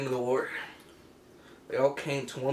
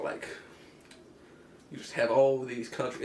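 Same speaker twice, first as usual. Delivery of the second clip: normal talking voice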